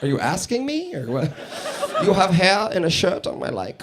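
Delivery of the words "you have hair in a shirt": creepy German compliment as a question; mock German accent, oddly questioning; awkward German flirt. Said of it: "It always sounds like they're asking a question".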